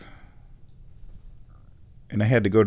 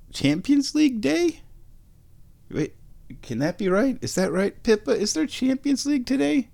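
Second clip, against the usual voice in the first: sudden surprise; 14 semitones higher than usual. Real, not acted. Suddenly realises match day.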